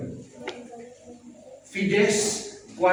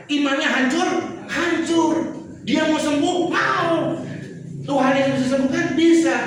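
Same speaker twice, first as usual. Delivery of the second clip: high, loud, driven sermon voice; fervent preaching; 8 semitones higher, 5 dB louder than usual. Acted. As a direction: build the woman's story with fire. Preaching at full force. (khotbah berapi-api dengan suara tinggi)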